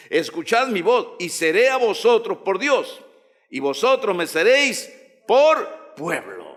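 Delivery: high, declamatory preacher's reading; scripture proclamation. Acted. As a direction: proclaim each phrase to the congregation.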